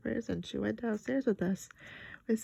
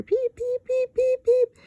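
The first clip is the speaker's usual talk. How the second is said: very high peeps building much louder; 11 dB louder, slower than usual. A narrator's reading. Lucille peeps louder and louder out of control. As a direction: crank each peep up higher and louder. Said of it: "peeping louder and louder".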